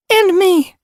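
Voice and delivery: put-on young kit voice.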